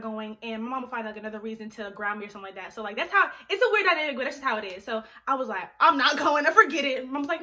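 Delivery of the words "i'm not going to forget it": retold outburst. Exasperated retold giving up.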